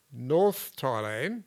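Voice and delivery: usual speaking voice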